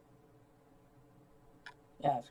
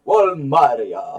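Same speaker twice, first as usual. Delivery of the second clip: loud, slow, drawn-out Walmaria; hammed-up name echo; 8 dB louder, much slower than usual. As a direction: bellow Walmaria, stretching each syllable. Co-host hams up the name.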